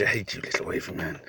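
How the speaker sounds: breathy whispered grudge; whispered hate. Acted. Gamer whispering mock hatred at the little wavy man. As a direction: hiss it under your breath with mock spite. Grudge against game character.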